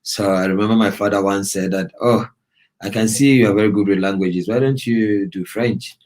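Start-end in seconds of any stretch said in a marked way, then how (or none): none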